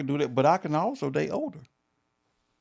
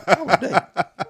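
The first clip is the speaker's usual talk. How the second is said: laughing reply; 6 semitones higher, 6 dB louder, much slower than usual. Real, not acted.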